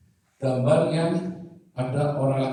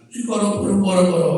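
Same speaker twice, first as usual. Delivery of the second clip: lightly imitated praying in tongues; mock charismatic tongues; 6 semitones higher, 6 dB louder than usual. Fanatically charismatic mother starts praying, mock tongues. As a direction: rattle it off like a fervent charismatic. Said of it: "mami saya kan orang karismatik fanatik".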